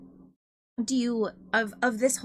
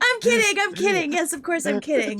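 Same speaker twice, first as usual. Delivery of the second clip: loud, high, laughing reassurance; laughing apology; 7 semitones higher, 8 dB louder than usual. Real, not acted.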